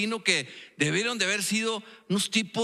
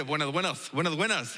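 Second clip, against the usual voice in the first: imitando a Oswi; 3 semitones lower than usual. Retold or imitated. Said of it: "una frase que siempre dice".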